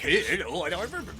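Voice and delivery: silly dopey voice, trailing soft; lightly voiced weird dumb voice. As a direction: goof around in the mirror. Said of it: "this weird ... dumb voice".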